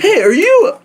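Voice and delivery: sudden high-pitched hey; high excited greeting. Retold or imitated. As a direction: squeak out an eager hello. Acted bar guy approaching.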